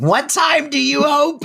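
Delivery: high mock call through the door; hammed-up Yetta shout; Yetta yelling. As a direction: pitch it up like old Yetta banging on the door. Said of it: "Yetta pounding on the door and going".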